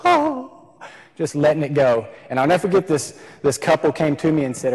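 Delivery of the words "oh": mock child wail. Retelling breaking down crying.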